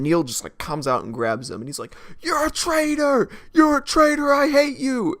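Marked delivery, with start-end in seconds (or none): 2.2-5.2 high, loud hammed-up accusation; mock furious yelling. Neil grabs the henchman, high loud hammed-up anger. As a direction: overact the betrayed hero.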